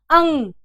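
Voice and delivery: अंग as one loud nasal "ung"; correct pronunciation demo. Shown as a demonstration. Teacher asking students to listen closely, loud pronunciation demo. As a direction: sound out अंग loudly, ending on a held "ng". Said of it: "इसका उच्चारण आप लोग ध्यान से सुने".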